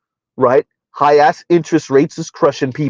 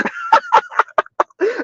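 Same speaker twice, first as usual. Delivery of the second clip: laugh; 4 dB quieter than usual. Real, not acted. Laughs at own remark.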